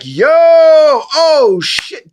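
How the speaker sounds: loud, high, drawn-out excited yell; giddy fan shout on meeting a star; starstruck yell. Retold or imitated. Excited greeting meeting celebrity.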